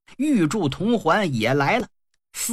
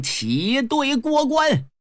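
high, long-drawn marching order; loud command cry; 8 semitones higher, much slower than usual. Acted. Huang Feihu orders his troops through the pass, calling out. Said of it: "黄飞虎高喊一声".

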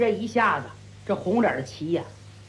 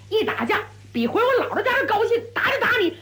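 eager for a fight; 7 semitones higher than usual. A narrator's reading. Brawler relishes the fight.

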